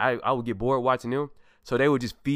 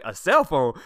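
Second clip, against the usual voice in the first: surprised reaction; 3 semitones higher, 6 dB louder, slower than usual. Retold or imitated. Retelling surprise phone gift.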